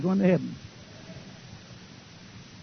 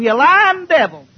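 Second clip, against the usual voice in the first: loud, slow, high yell; preacher screaming at the TV; screamed; 7 semitones higher, 6 dB louder, slower than usual. Retold or imitated. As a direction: yell it out at the devil. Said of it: "I, I screamed".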